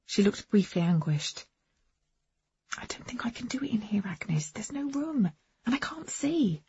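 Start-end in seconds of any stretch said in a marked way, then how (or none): 2.8-6.6 hurried unvoiced whisper. A narrator's reading. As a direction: whisper so nobody outside hears. Whispering so women outside can't hear.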